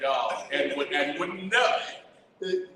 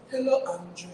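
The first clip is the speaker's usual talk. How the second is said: put-on light, higher-pitched French accent; 7 semitones higher than usual. A woman greeting him by name, French accent. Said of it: "Like, French accent".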